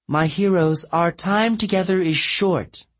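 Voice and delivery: high, regal goddess voice. A narrator's reading. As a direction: address them like a queen.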